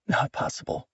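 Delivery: breathless whisper, barely there; whispered disbelief. Acted. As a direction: whisper it to herself in shock. Stunned inner thought.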